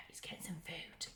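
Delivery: put-on hushed aside to the children; whispered aside to the listening children. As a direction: whisper it like a secret.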